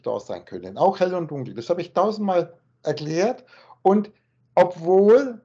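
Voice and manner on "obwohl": obwohl punched out loud, on its own; stress on obwohl. Shown as a demonstration. Teacher shows where the stress falls: loud obwohl. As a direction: hit the word obwohl hard and pause after it. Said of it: "die Betonung liegt hier".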